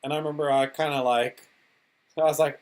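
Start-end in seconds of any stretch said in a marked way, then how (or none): none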